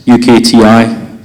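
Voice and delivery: ordinary delivery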